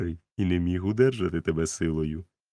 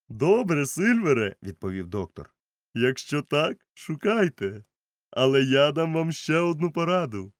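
higher voice, stern doctor; doctor advising; 9 semitones higher, 5 dB louder than usual. A narrator's reading. Doctor warns Silver, higher and firm. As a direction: give a warning with authority.

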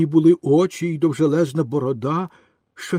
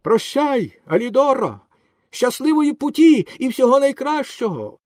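high farewell shout; calling out; 10 semitones higher, 4 dB louder than usual. A narrator's reading. Boy swimming off bids the rescued dog farewell, calling out. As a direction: shout goodbye across the water, much higher. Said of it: "гукнув урятованому".

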